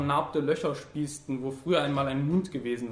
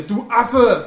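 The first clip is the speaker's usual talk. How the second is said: loud, high angry yell back; shouted insult; 8 semitones higher, 13 dB louder than usual. A narrator's reading. Wounded man returns his enemy's abuse, shouting angrily. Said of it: "rief der andere".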